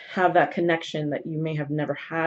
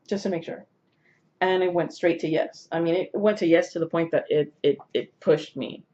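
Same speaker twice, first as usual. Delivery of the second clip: normal talking voice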